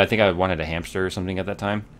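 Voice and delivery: ordinary talk, nothing marked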